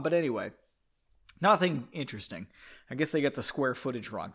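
ordinary delivery

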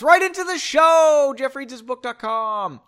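shouted high plug; loud upbeat shout to write in; call-out. Real, not acted. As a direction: call out to the listeners. Calling to listeners.

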